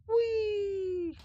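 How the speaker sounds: high gleeful squeal; gleeful whee. Real, not acted. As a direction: squeal with glee. Gleeful cry at enemies.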